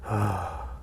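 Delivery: long slow exhale to calm down; soft, low sigh; deep calming breath. Retold or imitated. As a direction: let out a heavy sigh, playing the man calming himself. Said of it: "Takes a breath".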